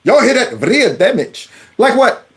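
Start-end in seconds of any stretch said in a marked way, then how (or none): 0.6-1.5 "Real damage" as "vreal demmitch", mock Indian accent; mimicked Indian accent. Host repeating "Real damage" for viewers, mock Indian accent. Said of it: "understand the heavy accent".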